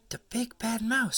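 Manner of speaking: high astonished gasp; awed little monster; amazed child. Acted. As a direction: marvel at it wide-eyed.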